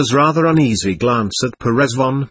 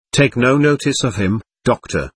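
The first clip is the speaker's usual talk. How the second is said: usual speaking voice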